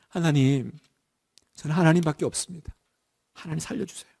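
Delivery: soft, quiet begging; pleading cry. Shown as a demonstration. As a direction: beg God for life, close to tears. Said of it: "울면서 매달려야 되잖아요". (울면서 매달리듯 낮고 조용하게)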